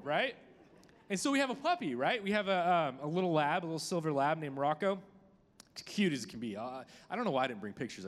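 usual speaking voice

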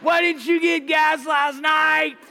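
loud, slow, high yell of scorn; angry yell. Retold or imitated. People staring at the driver yell at him in scorn. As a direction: bellow it like a furious stranger calling you stupid.

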